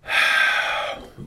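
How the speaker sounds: long resigned sigh; weary sigh. Acted. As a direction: sigh like you're beaten. Sighing before giving in.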